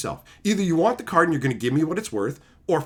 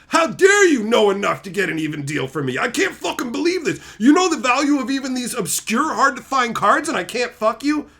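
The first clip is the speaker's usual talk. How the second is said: higher, louder indignant rant; mock outrage; 7 semitones higher, 4 dB louder than usual. Mocking an entitled trader, outraged, higher and louder. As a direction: act the entitled trader's fury. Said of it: "voicing entitled trader outraged".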